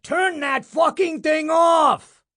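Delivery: very high, loud, slow shriek. A narrator's reading. Boss screaming at the deaf projectionist, full shriek. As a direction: scream it at the booth full force. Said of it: "he shrieked".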